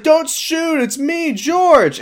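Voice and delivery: very high-pitched, hammed-up frightened plea; mock panic. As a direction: squeal it like a cartoon coward at gunpoint. Acting George pleading not to be shot.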